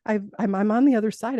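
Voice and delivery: mildly excited realization. Retold or imitated. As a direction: recall the thrill lightly. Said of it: "I was so excited".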